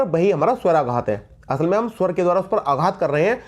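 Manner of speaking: normal talking voice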